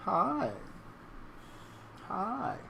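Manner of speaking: high little pet-talk voice; cooing greeting. Real, not acted. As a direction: greet a cat in a high coo. Greeting the cat cutely.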